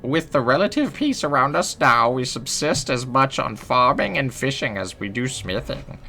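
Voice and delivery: put-on high-pitched elderly village historian; old woman telling local history; old lady voice. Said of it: "I hope the voice made it".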